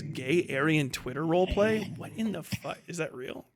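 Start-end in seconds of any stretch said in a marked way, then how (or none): none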